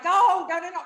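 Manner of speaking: high, loud mock panic; panicked refusal. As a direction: back away while begging no. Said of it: "I'm like".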